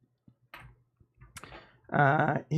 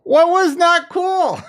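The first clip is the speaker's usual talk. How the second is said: much higher, slow, put-on mocking voice; mocking repeat; 14 semitones higher, 4 dB louder, slower than usual. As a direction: stretch it out like a sarcastic parody.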